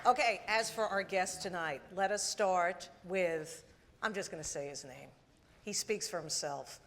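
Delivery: usual speaking voice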